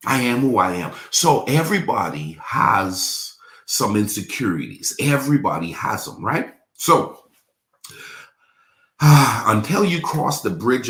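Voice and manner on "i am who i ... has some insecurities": usual speaking voice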